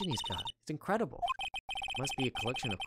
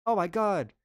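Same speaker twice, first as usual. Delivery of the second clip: higher and louder, slow alarmed cry; scared shout; 8 semitones higher, 8 dB louder than usual. Acted. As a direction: cry out as it comes closer. Tiger attack.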